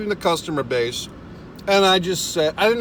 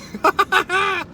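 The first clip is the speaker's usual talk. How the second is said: sudden high-pitched laugh; laughing at himself; high burst of laughter; 13 semitones higher than usual. Real, not acted. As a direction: let a squealy laugh escape. Laughing at own temper.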